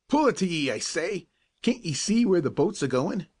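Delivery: high, scolding captain; angry yell. A narrator's reading. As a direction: yell at the clumsy boy. Captain yells at boy.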